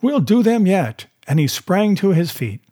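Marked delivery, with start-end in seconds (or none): 0.0-1.1 high-pitched, rousing exclamation; rally yourself against the deadline; excited, raised-pitch outburst; urgent cry. A narrator's reading. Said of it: "he cried".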